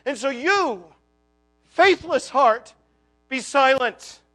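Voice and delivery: loud, driving sermon climax; preaching at full force. Acted. Preacher rallying the faithless heart, loud and fervent. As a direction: proclaim it over the congregation.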